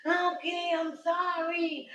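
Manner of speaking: high, slow coaxing; high drawn-out sorry; soothing grandma. Retold or imitated. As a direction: coo to calm a crying child. Grandma soothing crying child.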